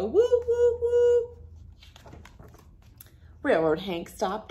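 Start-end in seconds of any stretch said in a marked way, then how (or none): 0.0-1.3 loud, high woo woo like a train whistle. Acted. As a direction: blow the whistle with your voice.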